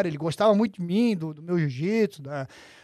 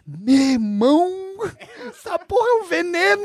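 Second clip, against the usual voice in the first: shocked Renzo; 11 semitones higher than usual. Retold or imitated. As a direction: drag out "Meu irmão", then burst out high. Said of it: "com os olhos arregalados".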